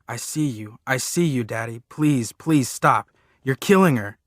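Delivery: shrieking at him, pleading and loud; child screaming at his father to stop; desperate screaming. Acted. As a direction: scream to make him let go. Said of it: "I'm going".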